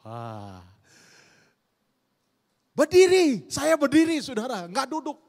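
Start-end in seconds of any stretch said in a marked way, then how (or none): 2.8-5.2 high, loud burst of retold excitement; excited outburst. Pastor recalling waiting for his sweetheart, excited shout. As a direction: jump up in eager excitement. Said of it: "Kena excited".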